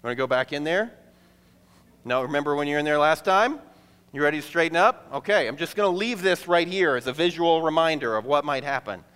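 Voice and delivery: lightly menacing parent wheeling out the fish tank; mock stern parent. Parent threatening a sassy kid with the fish, mock stern. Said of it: "and be like".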